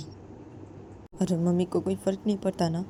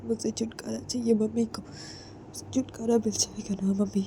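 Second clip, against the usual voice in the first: despairing whisper; 5 semitones higher, 6 dB quieter than usual. Acted. Distraught bride talks of dying.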